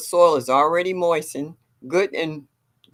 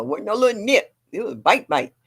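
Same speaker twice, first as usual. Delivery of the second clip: ordinary talk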